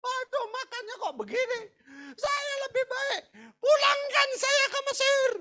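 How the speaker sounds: high, whiny mock wail; bersungut-sungut. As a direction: grumble in a hammed-up tearful high voice. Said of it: "mereka bersungut-sungut". (rengekan pura-pura, tinggi dan melengking)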